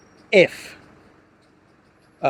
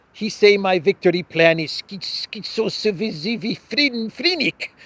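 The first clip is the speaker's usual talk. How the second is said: mock broken English; 5 semitones higher, slower than usual. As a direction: stretch out and mangle "schizophrenic" slowly, mocking. Mocking Podolyak's poor English.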